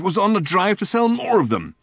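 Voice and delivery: high, loud exasperated rant; Ford's high, loud, fed-up rant. Acted. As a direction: rant it out in a fury. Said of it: "ranted Ford".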